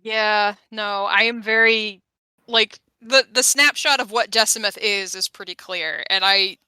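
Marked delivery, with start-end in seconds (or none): none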